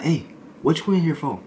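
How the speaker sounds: a mock tough inmate sizing him up.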